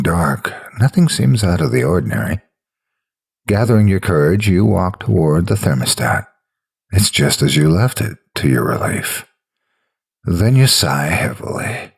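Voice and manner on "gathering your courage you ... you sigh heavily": ordinary delivery, nothing marked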